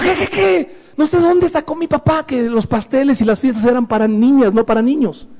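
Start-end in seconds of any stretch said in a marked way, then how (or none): 0.0-0.8 high shocked "¿Qué, qué, qué?" from the father; father's shocked "qué". Retold or imitated. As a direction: play the father, startled and offended, pitched well up. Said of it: "Y me dijo". (padre sorprendido, con voz mucho más aguda)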